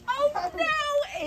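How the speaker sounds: high mock groan. A narrator's reading. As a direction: whine it like a fed-up girl. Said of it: "Ava groaned".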